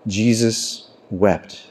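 slow, low and soft; hushed solemnity. Acted. Preacher quoting the shortest Bible verse, hushed and solemn. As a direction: let the short verse land gently, with weight.

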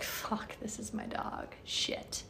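whispered, breathless realization; stunned whisper. Retold or imitated. Telling of meeting Cade, a stunned whisper. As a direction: breathe it out like a secret. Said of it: "I was like".

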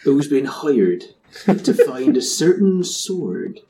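hammed-up slow West Country pirate accent. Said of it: "he sounds like a West Country pirate".

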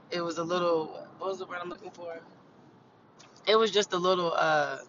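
high and groping for words; stoned. Real, not acted. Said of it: "I'm a little high right now".

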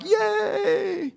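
put-on high, childlike yay; kids cheering. Pastor voicing cheering children, high childish yay. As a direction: squeal it like a happy kid. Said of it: "but the kids are like".

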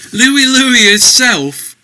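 high, loud, slow incredulous echo; mocking disbelief. A narrator's reading. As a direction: scoff at the name in disbelief. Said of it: "the Skyrat laughed at him".

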